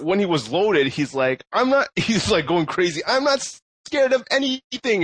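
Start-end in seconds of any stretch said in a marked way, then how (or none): 1.5-1.9 put-on French accent. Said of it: "in his French accent". 3.1-5.0 put-on French accent. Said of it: "in his French accent".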